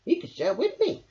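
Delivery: excited hedgehog voice; eager Hedgehog. A narrator's reading. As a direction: offer eagerly. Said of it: "cried Hedgehog".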